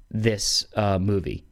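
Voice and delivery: normal talking voice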